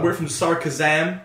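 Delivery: slow, badly faked American accent; terrible put-on American. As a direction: stretch the place name in fake American. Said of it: "these really bad American accents".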